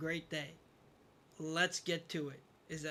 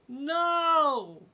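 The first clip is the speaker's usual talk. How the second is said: anguished no; 9 semitones higher, 9 dB louder than usual. Real, not acted. Reacting to failed boss attempt.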